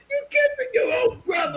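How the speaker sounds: high squealed accusation through laughter; hammed-up, high-pitched disbelief breaking into laughs; mock outrage, laughing. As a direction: act appalled while cracking up. Acts out outraged man, laughing.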